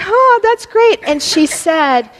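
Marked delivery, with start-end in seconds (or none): 0.1-1.0 high, bright, delighted exclamation; delighted reply. Retold or imitated. Coach replying to her player's good news, excited and high. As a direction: gush with surprised joy. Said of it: "And I was like".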